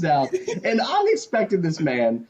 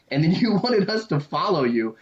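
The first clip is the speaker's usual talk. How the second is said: ordinary talk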